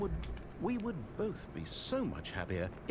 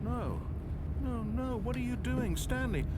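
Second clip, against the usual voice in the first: pleading; 11 semitones higher than usual. Acted. Narrator begs Stanley not to jump.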